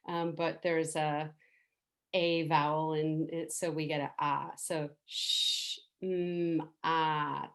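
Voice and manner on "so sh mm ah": sh, mm, ah said slowly one by one; Shema syllables. Shown as a demonstration. Teacher sounding out Shema syllable by syllable. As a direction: break the word into its three sounds. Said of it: "that's the sound of Shema".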